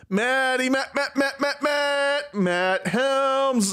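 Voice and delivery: high, playful sing-song calling; sing-song name call. Pretend. Calling out to chat in a high sing-song.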